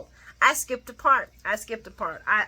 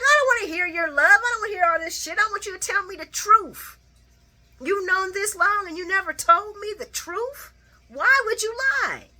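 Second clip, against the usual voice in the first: higher, louder mock yell; hammed-up angry father tearing into his son; furious Sonny; 12 semitones higher, 6 dB louder than usual. Sonny yelling at Michael for hiding the truth, loud mock anger.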